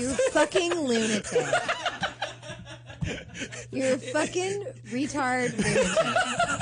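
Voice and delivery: laughing outburst. Real, not acted. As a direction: cry it out through laughter. Wife reacts laughing to letter.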